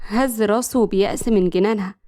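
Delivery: plain reading voice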